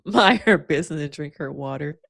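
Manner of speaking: ordinary talk, nothing marked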